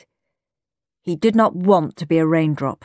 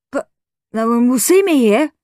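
put-on higher-pitched child's objection; protesting boy; 5 semitones higher, 4 dB louder than usual. A narrator's reading. Henry protests to his teacher.

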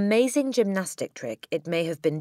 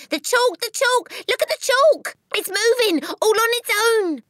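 put-on very high, loud child's shriek; hysterical scream; 8 dB louder than usual. A narrator's reading. Nigel pointing at the moving chalk, screaming. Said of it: "and screaming".

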